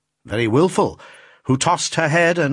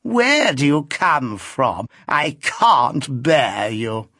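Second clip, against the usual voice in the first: put-on slow, affected old lady voice; an old lady's slow, affected complaint; insipid old lady; 2 semitones higher, much slower than usual. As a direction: languid and coy, in no hurry. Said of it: "said the old lady in the chair insipidly".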